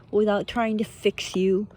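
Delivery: normal talking voice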